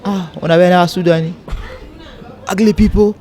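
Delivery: high, jeering mock taunt; a hint of a jeering local boy mocking a girl dating a Sudanese. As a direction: imitate boys sneering at the girl. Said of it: "They mock the girl".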